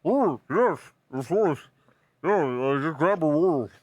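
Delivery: talking through a mouthful of bread; mouth full. Acted. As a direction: speak around a whole roll. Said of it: "Is it common to talk with your mouth full here?".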